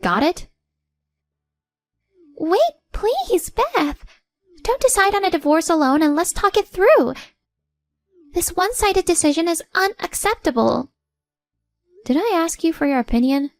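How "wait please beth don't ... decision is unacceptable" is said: high-pitched, urgent character voice; pleading mother-in-law. Acted. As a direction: beg her to stop, pitched high. Plays mother-in-law pleading against divorce.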